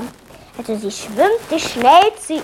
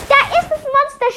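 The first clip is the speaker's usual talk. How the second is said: much higher shouted alarm; shouting; 12 semitones higher than usual. A narrator's reading. Emilia cries out that the monster is here. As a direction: cry out the warning up high.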